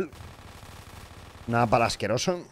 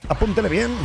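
high, loud "Apúntele bien" with a rise on "bien"; mock command; 10 semitones higher, 5 dB louder than usual. Gamer urging himself on, high and loud mock order.

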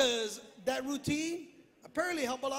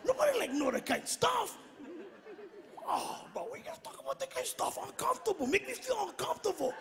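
uncles' quote; 6 semitones higher, 7 dB quieter, faster than usual. Retold or imitated. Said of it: "big brothers come up to me going".

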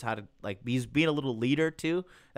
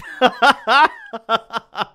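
high, loud burst of cackling; real loud laughter; 10 semitones higher, 11 dB louder than usual. Real, not acted. Laughing at a chat joke.

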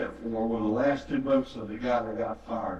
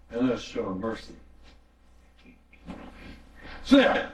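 higher, ringing pulpit voice; full-force preaching; 6 semitones higher than usual. Acted. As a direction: drive the message home to the congregation.

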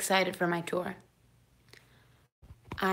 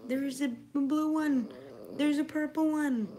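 voicing the dog; 6 semitones higher than usual. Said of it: "You're like".

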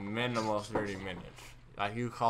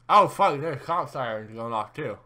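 sudden loud alarm; startled; 5 semitones higher, 13 dB louder than usual. Real, not acted. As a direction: blurt it out startled. Startled by sirens.

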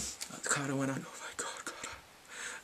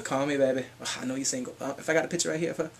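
ordinary talk

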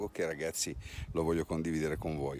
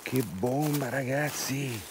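excited; 5 semitones higher, 3 dB louder, slower than usual. Real, not acted. Excited at a big find.

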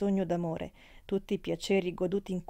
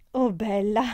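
louder, offended "Oh, bella!"; the friend takes offence at the count; indignant exclamation; 2 semitones higher, 6 dB louder than usual. Acted. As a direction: bristle at the count's question. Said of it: "esclamò lei".

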